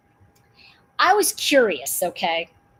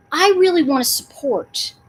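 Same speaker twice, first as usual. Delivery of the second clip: ordinary talk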